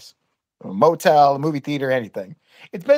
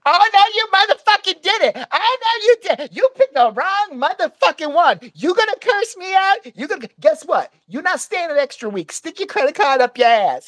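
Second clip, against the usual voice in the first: angry yelling woman; 10 semitones higher, 3 dB louder than usual. Retold or imitated. Hotel clerk throwing out a rude guest, mock angry yelling. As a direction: imitate a woman cussing someone out, voice pitched way up.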